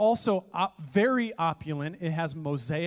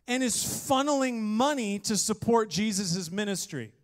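ordinary delivery

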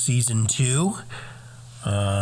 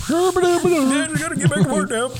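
mock high voice; 5 dB louder than usual.

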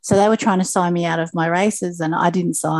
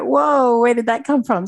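mild retold amazement, pitched up; a higher "whoa", replaying the surprise; astonished; 5 semitones higher than usual. Swimmer recalls an unexpected relay win, astonished.

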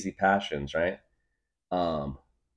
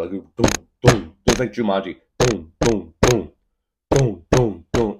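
drumbeat imitation; 8 dB louder than usual. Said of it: "like a bongo".

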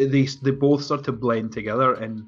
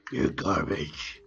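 slow, strained mock growl; Batman; 7 semitones higher, much slower than usual. Insulting in jest as Batman, slow strained growl. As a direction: drag it out in a put-on rasping growl. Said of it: "the face that he's making when he does this".

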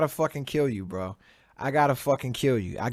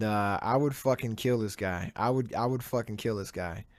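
normal talking voice

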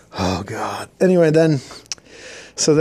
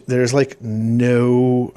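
usual speaking voice

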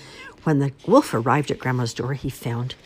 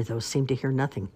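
ordinary delivery

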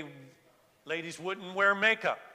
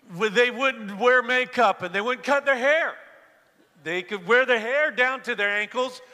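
loud, higher-pitched preaching with heavy emphasis; impassioned preaching; 5 semitones higher, 7 dB louder than usual. Real, not acted. Preacher tells congregation about man-made rules, impassioned preaching. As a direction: preach it out forcefully to the whole room.

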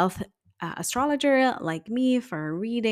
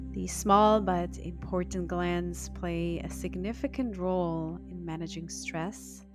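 guided meditation; 3 dB quieter than usual. Acted. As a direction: guide the listener into calm.

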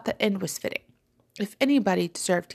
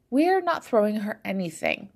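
ordinary delivery, nothing marked

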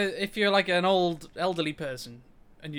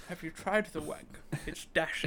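posh voice; 3 dB quieter than usual. Pretend. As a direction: put on a silly posh accent. Posh silly voice, laughing.

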